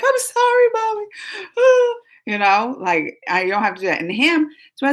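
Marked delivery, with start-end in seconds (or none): 0.0-1.0 put-on high, tearful little-girl apology; little daughter. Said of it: "She'll be like". 1.1-2.0 mock crying sigh. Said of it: "She'll be like".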